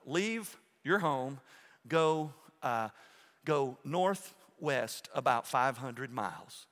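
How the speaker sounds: slow, evenly spaced sat-nav voice; phone navigation voice. Retold or imitated. Pastor voicing the phone's navigation app to his congregation, slow and mechanical. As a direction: read it out like the map app giving directions. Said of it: "the voice is gonna come out of the phone".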